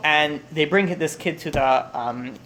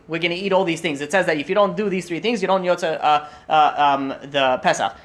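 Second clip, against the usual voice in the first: ordinary delivery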